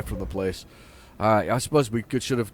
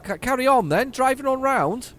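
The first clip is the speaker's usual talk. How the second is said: sarcastic; 13 semitones higher, 5 dB louder than usual. Real, not acted. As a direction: wave the traffic on in high-pitched sarcasm. Sarcastic at slow traffic.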